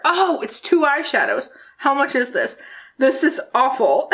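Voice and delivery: ordinary delivery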